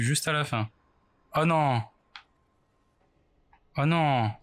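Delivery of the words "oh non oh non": ordinary talk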